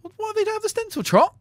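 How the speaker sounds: high-pitched incredulous what; indignant disbelief. Real, not acted. Outraged at Trot's idea.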